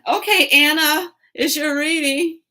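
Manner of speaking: high, loud sing-song pitch; card reader pressing a stranger, high and loud; pushy reader. Retold or imitated. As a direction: sell the reading brightly. Reader announcing a reading.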